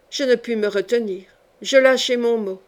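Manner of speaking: ordinary delivery, nothing marked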